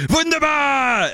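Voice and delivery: high, drawn-out shouted WUNDERBAR; exuberant cry. Acted. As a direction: belt it out like a candy-bar slogan, stretching the last syllable. Corrects himself with jingle-like cry.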